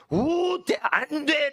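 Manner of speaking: high, drawn-out proclamation; emphatic prohibition. Acted. Preacher acting out the original text's list of bans, emphatic prohibition. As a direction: intone each ban high and forcefully.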